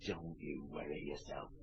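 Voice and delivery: whispered confidingly; a sly, hushed whisper to the mother. Acted. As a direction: lean in and whisper it.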